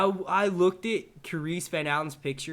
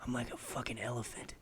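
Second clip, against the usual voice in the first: mock creepy; 3 semitones lower, 4 dB quieter than usual. Streamer teasing a loyal viewer, mock creepy. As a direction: put on a quiet, weird intensity. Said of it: "That was probably weird as shit".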